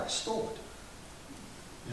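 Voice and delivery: breathy whispered admission, drawn out; hushed confession. Acted. Speeder quietly confesses to the officer he stole the car. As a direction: let the confession slip out under your breath.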